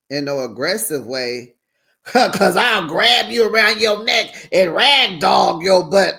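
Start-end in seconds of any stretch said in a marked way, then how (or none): 2.0-6.2 loud, slow laughing threat; laughing mock threat.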